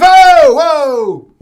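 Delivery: very loud, high alarmed whoa; startled whoa. Retold or imitated. Tourist startled by the shop guy's yelling. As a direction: recoil in mock alarm. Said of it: "You're like".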